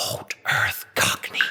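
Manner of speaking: soft whispered answer. Acted. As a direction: breathe it back quietly. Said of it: "he whispered back".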